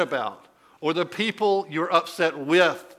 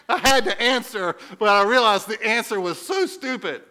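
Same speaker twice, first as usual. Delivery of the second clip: ordinary talk, nothing marked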